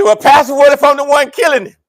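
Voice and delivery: mock cheeky congregant. Congregant imitated asking cheeky question.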